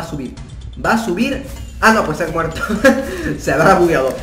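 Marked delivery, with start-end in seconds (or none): 2.7-3.3 high, quick laugh; surprised laugh. Real, not acted. Gamer laughing as the enemy glitches, high laugh.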